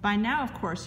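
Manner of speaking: usual speaking voice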